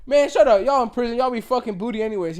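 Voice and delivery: mad. Retold or imitated. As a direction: snap back at him, shouting. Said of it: "he gets so mad".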